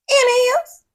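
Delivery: high, loud sassy jab; sassy aside. Real, not acted. Host butting in on the couple, loud and sassy. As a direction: snap it at the screen. Snaps back at the screen.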